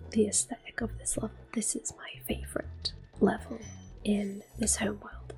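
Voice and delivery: ordinary delivery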